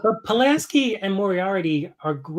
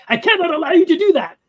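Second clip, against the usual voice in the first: loud, high dramatic captain; Picard chewing the scenery; 10 semitones higher, 6 dB louder than usual. Retold or imitated. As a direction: ham it up like a Shakespearean captain. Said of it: "Patrick Stewart really chewing the scenery".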